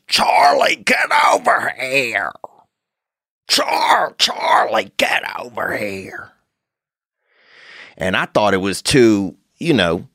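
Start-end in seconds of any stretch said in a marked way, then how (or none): 0.1-2.3 high strained mock yell at a dog; old man yelling at dog. Old man in the park yelling at his dog, high mock yell. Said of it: "he goes". 3.5-6.3 high strained call fading to a breathy hush; fading old man call. Retold or imitated. Old man calling his dog again, yell fading off. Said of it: "he goes".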